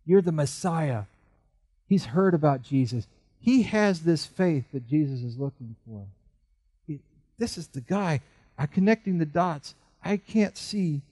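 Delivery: ordinary delivery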